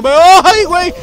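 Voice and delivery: sudden high loud cry; real surprised yelp; startled yelp. Real, not acted. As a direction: jump at the near miss. Ball nearly hits him.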